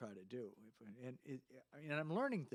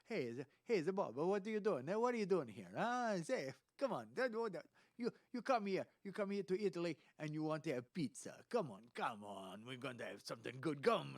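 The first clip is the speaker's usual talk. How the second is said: loud, higher-pitched mock Italian; caricature Italian accent; 5 semitones higher, 6 dB louder than usual. Shown as a demonstration. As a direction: ham up a cartoon Italian welcome. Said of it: "yes, it's a caricature. It's like".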